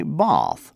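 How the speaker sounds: bath with a long ah, bahth, said slowly; British bath. Shown as a demonstration. Teacher contrasting for students, British long-a in bath. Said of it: "the British say".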